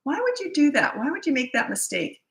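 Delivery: lightly acted scolding boss; mock berating boss. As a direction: needle the employee with each why. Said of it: "if you were a boss".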